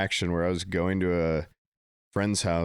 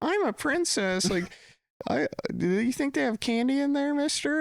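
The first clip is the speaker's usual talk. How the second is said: put-on sweet little girl. Little girl asking the narrator for candy, high sweet voice. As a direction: squeak it like a tiny princess. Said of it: "so sweet and was like".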